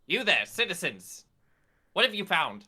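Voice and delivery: high, loud haughty call; imperious Esther. Acted. Esther looks down on strangers as she approaches, haughty. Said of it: "and I say".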